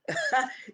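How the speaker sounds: short, high-pitched laugh; brief burst of laughter. Real, not acted. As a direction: let out a quick high laugh. Greeting viewers as they join.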